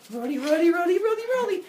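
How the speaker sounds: high, loud rolling babble; rolling Play-Doh noise. Retold or imitated. Epimetheus rolling green Play-Doh, excited babble. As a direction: rattle off a high mock rolling sound.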